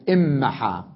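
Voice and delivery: إمحى with a doubled, held m; nun merged into mim. Shown as a demonstration. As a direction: say إمحى with the n assimilated into m. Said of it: "وأدغمها بالميم تصبح".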